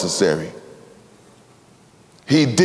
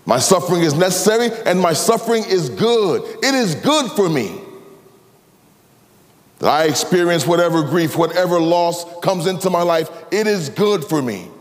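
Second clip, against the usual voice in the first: higher, louder preacher's cry; impassioned preaching; 9 semitones higher, 3 dB louder than usual. Acted. Preacher to congregation, impassioned full-force preaching. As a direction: proclaim it with rising fervour.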